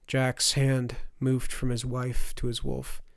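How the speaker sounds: ordinary delivery, nothing marked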